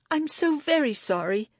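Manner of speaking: high, flustered apology; stammered apology. A narrator's reading. Libby apologizing to the offended librarian, stammering. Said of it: "Libby stammered".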